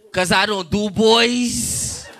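ordinary talk, nothing marked

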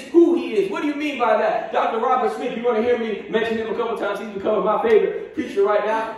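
ordinary talk, nothing marked

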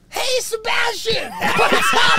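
high, loud excited greeting; bright shouted hello. Retold or imitated. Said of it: "he was like".

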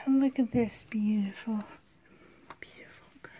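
soft breathy whisper over the doll. Real, not acted. To viewers, cooing over the baby doll: soft whisper.

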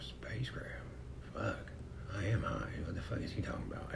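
hushed, panicky whisper, racing at the end; paranoid whisper. Retold or imitated. Stoned guy freaking out to himself in a whisper. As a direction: mutter your panic under your breath.

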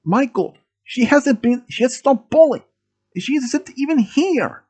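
high-pitched urgent pleading; trying to calm. A narrator's reading. Milo tries to calm the gunman, pleading. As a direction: plead high to talk him down. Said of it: "tried to calm him down".

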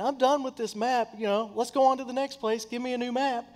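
high, breezy fake-cheerful; mock cheery brush-off. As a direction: brush her off brightly, done with it all. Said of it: "I almost thought about saying".

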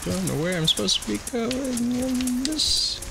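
high whiny voice. Acted. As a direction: whimper like a lost little creature. Confused whining at game.

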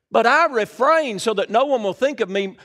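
forceful preacher reading from the Bible; scripture reading. Acted. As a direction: proclaim the verse to the whole room. Reads scripture aloud to congregation.

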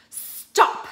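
loud sudden stop; sharp command. A narrator's reading. Witch halting the crowd, loud sharp stop. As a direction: halt them with one word. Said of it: "said the witch".

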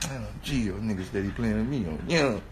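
low, soft imitated mutter; mock mumbled complaint; mumbling. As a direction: grumble half to yourself, softly. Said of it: "He was really mumbling".

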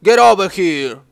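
a hint of Scorpion's growled challenge; mock the fighter's taunt, lightly voiced; playful Scorpion imitation; Scorpion impression. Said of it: "como si fuera Scorpion".

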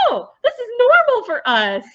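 high, loud mock revelation; relieved realization. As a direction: act out delighted relief at fitting in.

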